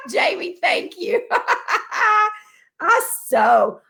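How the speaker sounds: high, laughing through the thank-you. Real, not acted. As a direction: thank her while still cracking up. Laughing while thanking Jamie.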